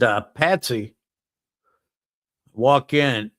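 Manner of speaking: normal talking voice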